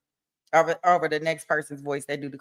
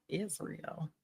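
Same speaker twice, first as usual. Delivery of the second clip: softly savored name; 17 dB quieter than usual. Shown as a demonstration. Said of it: "Kinda rolls off your tongue".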